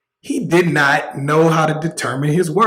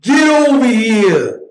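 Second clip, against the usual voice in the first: slow, loud, high mock New York accent; hammed-up New York accent; bad New York accent; 7 semitones higher, 5 dB louder, slower than usual. Shown as a demonstration. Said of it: "That's like the worst New York accent ever".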